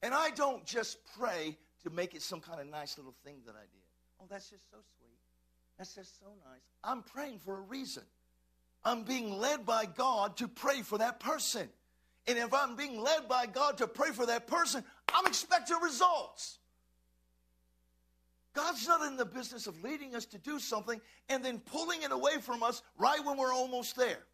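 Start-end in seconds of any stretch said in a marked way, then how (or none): 4.2-6.6 soft, lightly cooed mimicry; mock sweet approval. Onlookers reacting to his prayer, mock sweetly. 8.8-24.3 emphatic sermon voice building to a loud peak; forceful preaching. Real, not acted. Pastor insisting prayer brings results, forceful preaching.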